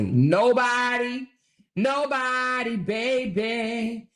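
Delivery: "Nobody" stretched long and high; sassy, drawn-out insistence on "Nobody"; emphatic repetition. Real, not acted. Emphatic repeat after plain takes.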